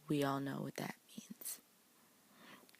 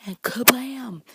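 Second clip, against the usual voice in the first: a loud, high burst of a word; loud kablam; 6 semitones higher, 13 dB louder, slower than usual. Acted. Recapping Elsa's ice blast for viewers, booming kablam. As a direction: blurt kablam out like an explosion.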